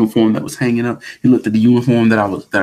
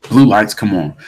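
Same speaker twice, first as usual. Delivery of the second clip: ordinary talk, nothing marked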